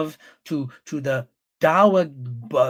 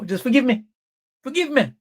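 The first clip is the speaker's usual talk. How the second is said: higher mocking plea, rising on the second forgive; mock pleading; 8 semitones higher than usual. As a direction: beg in a sneering put-on voice. Mock pleading, continuing the woman voice.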